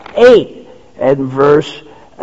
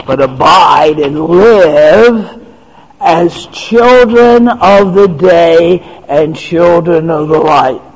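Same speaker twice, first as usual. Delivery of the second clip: rising, louder preacher cadence, slow on the quotes; preaching at full force; 4 dB louder than usual. Acted. Preacher proclaims to the congregation, fervent and rising. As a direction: build the list like a pulpit crescendo.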